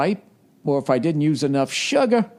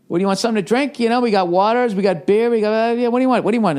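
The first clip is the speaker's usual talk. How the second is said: mock party host; 8 semitones higher, faster than usual. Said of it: "host offering drinks".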